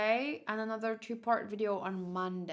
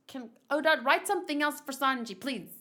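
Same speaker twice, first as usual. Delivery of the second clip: playful pleading; 3 semitones higher, 7 dB louder than usual. Pretend. Pleading directly to Oda.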